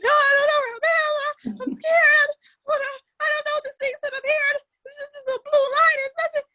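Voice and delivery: high, tearful, loud cries of a lost driver; crying and scared. Retold or imitated. Lost on the phone to her friend, crying high and scared. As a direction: sob it out, voice breaking high. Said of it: "I'm like".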